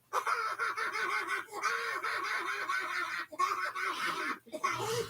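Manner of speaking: gleeful laugh. Acted. Laughing at the photo.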